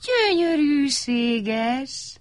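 drawn-out, high and awed; slow admiring gyönyörűséges. A narrator's reading. Mindannyian a felhőt csodálják, áhítattal. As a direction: savour the wonder. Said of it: "mondták mindannyian".

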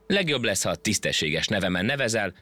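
usual speaking voice

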